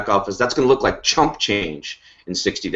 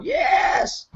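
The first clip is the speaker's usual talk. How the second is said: a much higher, thrilled shout of "Yes!"; imitated excited yes. Host imitating a thrilled teammate, high shouted cheer. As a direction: squeal it like a fan cheering. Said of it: "Ray's watching this going".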